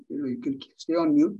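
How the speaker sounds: ordinary delivery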